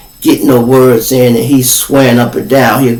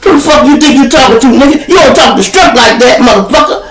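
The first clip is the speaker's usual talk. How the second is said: high, loud yelling tirade; Daddy-O shouting down the drunk in the back seat; angry shout; 12 semitones higher, 3 dB louder than usual. Retold or imitated. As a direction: blast him like an angry uncle. Daddy-O yells at drunk friend.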